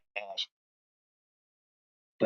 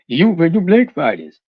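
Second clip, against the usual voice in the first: louder mocking parody of critics; 4 semitones higher, 7 dB louder than usual. Mimicking a commenter, loud sneering parody.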